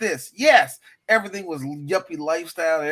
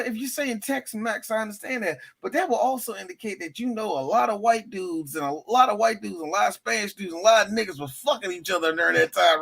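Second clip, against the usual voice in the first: ordinary delivery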